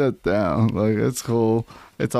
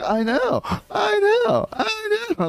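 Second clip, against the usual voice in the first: whimpering "I know" much higher, mocking her; mock whimpering Lisa; slower than usual. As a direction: squeak it out like a teary fake-innocent Lisa. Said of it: "And Lisa's like".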